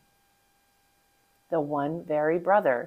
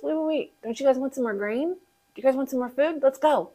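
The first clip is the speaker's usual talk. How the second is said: calling them back; 7 semitones higher than usual. Acted. Joseph calling brothers back.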